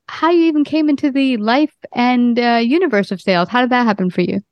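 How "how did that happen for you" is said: ordinary talk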